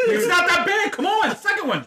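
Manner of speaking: high laughing words. Real, not acted. Laughing through the burn.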